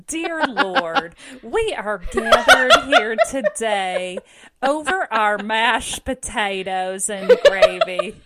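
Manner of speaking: loud, slow, hammed-up Southern prayer; Southern Baptist grace. Churchgoer saying grace over mashed potatoes, mock Southern Baptist drawl.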